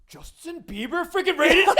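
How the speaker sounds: high, loud fan shriek breaking into laughter; mock freak-out. Imagining being raided by [name], laughing mock freak-out. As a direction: play a starstruck fan and crack up. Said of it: "I would freak out too".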